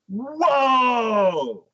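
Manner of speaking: high, loud awed shout; awed whoa. Retold or imitated. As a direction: gasp at the animation. Own awed reaction.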